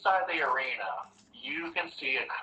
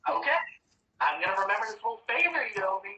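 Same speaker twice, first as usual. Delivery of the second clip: high, fast, teasing threat; smug witch queen; 8 semitones higher, faster than usual. Acted. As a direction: purr a quick high warning about the debt. Queen warns the player.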